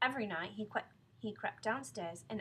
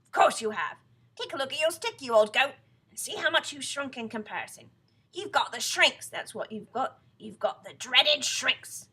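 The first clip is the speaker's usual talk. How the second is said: loud, raised, hectoring husband; bullying Mr Twit; 4 semitones higher, 13 dB louder than usual. Acted. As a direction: hammer the diagnosis home.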